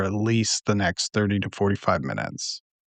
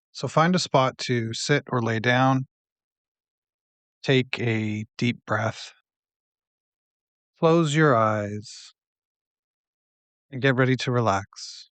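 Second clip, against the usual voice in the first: unhurried, settling into softer, lower phrases; guided meditation; 3 semitones lower, 6 dB quieter than usual. Acted.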